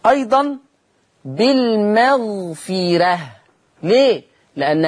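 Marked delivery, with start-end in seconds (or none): 1.3-3.2 slow recited بالمغفرة ending in thin "reh"; light ra. Shown as a demonstration. Teacher gives another tarqiq example, slow light "reh" in بالمغفرة. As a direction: linger on بالمغفرة, keeping the ra fronted and light. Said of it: "فهو ترقيق الراء".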